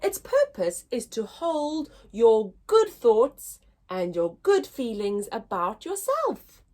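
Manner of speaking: ordinary delivery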